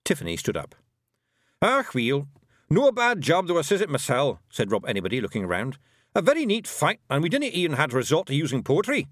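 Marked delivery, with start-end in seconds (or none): 1.6-4.4 high, cocky Scots brogue; a proud wee Feegle warrior; broad Scots Feegle; Scottish pixie voice. A narrator's reading. Rob Anybody's Feegle voice. 6.2-9.1 high, cocky Scots brogue; a proud wee Feegle warrior; broad Scots Feegle; Scottish pixie voice. A narrator's reading. Rob Anybody's Feegle voice.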